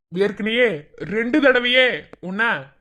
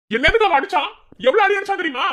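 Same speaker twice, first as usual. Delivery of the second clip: high-pitched, heated outburst; angry protest; 5 semitones higher, 3 dB louder than usual. Acted. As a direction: demand an answer, aggrieved and heated. Acted quarrel, protesting a beating. (கோபத்தோடு குரல் உயர்த்தி)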